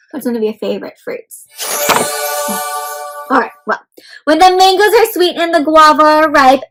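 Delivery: ordinary delivery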